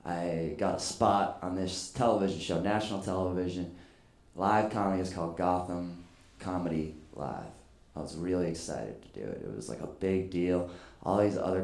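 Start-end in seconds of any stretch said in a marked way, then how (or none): none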